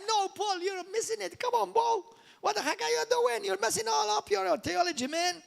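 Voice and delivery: lightly voiced mock rebuke, pitched high; mock scolding. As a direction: tease Paul like a friend gone wrong. Mock scolding Paul over theology.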